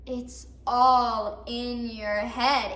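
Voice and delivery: very slow, stretched-out dismissal; patronizing refrain. Acted. Others answering an oversensitive woman, slowly dismissive. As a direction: draw out ALL and lean on it.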